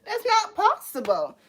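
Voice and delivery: squeaky-high, slow repeat; mock protest. Repeating the answer to the shark, high mock protest. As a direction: stretch it out way up high. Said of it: "Yelling doesn't make you correct".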